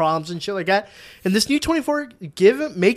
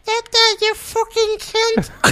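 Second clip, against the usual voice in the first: put-on very high, slow posh baby; a baby royal swearing, drawn out; slower than usual. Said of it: "Do you wanna hear the royal baby?".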